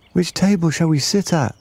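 put-on high-pitched questioning child; child's voice. A narrator's reading. Child asks siblings about table.

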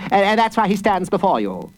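high, eager prosecutor's voice. A narrator's reading. Prosecutor explaining the boy's habit to the judge, insistent. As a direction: protest deferentially, pitched high. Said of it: "said the prosecutor".

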